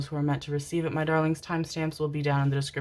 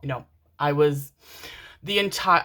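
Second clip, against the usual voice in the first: normal talking voice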